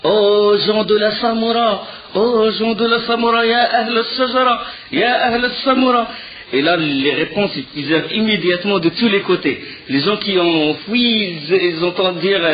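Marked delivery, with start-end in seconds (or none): 0.0-6.1 long, high rallying call, vowels stretched; imitated far-carrying herald's cry. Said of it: "il cria".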